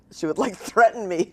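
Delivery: ordinary delivery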